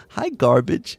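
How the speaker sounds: a hint of a cheeky kid; retold child. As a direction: mimic a child's loud hello. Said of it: "He'll go".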